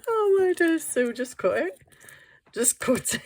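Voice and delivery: high, helpless laughing voice; giggly self-scolding. Real, not acted. Crafter urges herself to just cut it, giggling. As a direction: tell yourself off through giggles.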